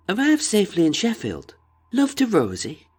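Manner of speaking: high-pitched put-on voice of a wife; light, high woman's impression; wife's voice. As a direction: read the telegram in a high voice. Wife's supposed telegram read out.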